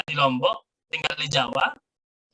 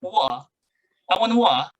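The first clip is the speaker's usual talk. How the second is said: higher, indistinct Edinburgh-style "water"; unclear Edinburgh accent; 5 semitones higher, much slower than usual. Shown as a demonstration. Teacher mimics northern British speakers, unclear Edinburgh accent. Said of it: "arah Edinburgh misalnya, dia waternya nggak jelas".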